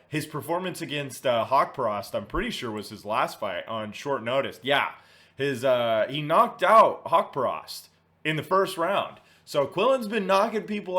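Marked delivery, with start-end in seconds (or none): none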